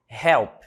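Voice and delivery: Help with the h breathed out hard, like a Brazilian R; English h sound. Shown as a demonstration. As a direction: say Help with a strong breathy h, the way Portuguese says R. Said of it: "Tem som de R".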